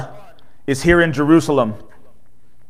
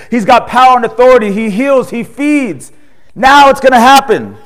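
higher, louder, eager crowd voice; excited crowd; 5 semitones higher, 4 dB louder than usual. Retold or imitated. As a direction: cheer like people who think rescue has come. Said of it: "They say".

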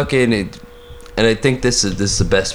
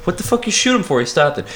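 cop voice; 7 semitones higher than usual. Acted. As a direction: play a trigger-happy cop. Acted cop shooting scene.

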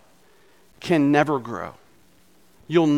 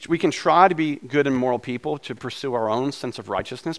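ordinary talk

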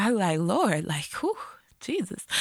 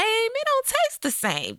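sassy high emphasis; 13 semitones higher, slower than usual. Pretend. Repeats the line for effect.

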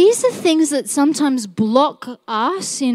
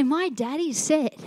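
put-on childlike comeback; 4 semitones higher, 6 dB quieter than usual. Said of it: "And I just say".

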